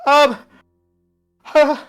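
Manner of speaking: high, loud helpless hesitation; lost and frightened; panicked stammer. Acted. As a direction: falter in rising panic. Wizard panicking.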